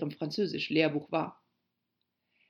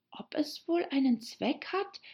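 put-on higher-pitched girl character, wondering; nachdenkliche Alice; 9 semitones higher than usual. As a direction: voice Alice pondering to herself. Story figure Alice thinking. (nachdenklich fragende Mädchenstimme)

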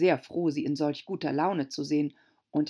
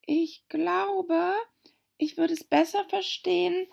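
put-on a much higher polite girl's voice; Alice, höflich; 13 semitones higher than usual. A narrator's reading. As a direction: ask very politely. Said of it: "sagte Alice sehr höflich". (Alice bittet sehr höflich, deutlich höher)

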